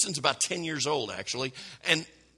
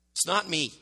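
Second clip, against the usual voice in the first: usual speaking voice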